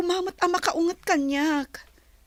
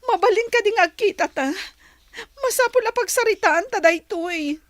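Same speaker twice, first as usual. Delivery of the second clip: louder, rising desperate plea; begging him to talk it through; pleading; 4 semitones higher, 7 dB louder than usual. Acted. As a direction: voice climbing in distress. Pleading with boyfriend in breakup.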